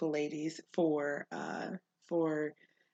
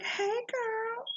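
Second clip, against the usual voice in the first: put-on much higher, bubbly hey girl; squealed greeting. Host greets a stranger at the gas station with a high squeal. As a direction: greet her like an old friend.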